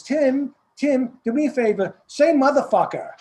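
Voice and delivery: high, loud mock coach calling out to Tim; a dialect coach asking for a swear word; imitating the coach. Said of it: "She says".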